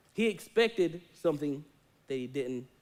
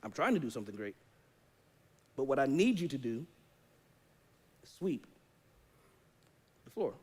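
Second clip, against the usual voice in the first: lower, calm voice of God; a lightly lowered God voice replying to a whining believer; patient God; 6 semitones lower, 6 dB quieter than usual. Acted. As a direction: answer gently from above. God answering the complaining believer.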